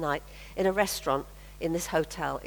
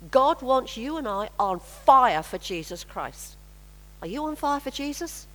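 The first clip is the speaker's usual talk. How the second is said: fervent preaching; 3 semitones higher than usual. Acted. Preacher calls congregation on fire.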